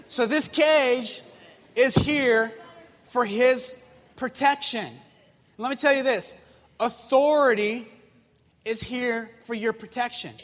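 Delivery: preacher pressing the lesson on the youth group, slow and forceful; preaching. Acted. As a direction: hammer each word home from the pulpit. Preacher drives home the point.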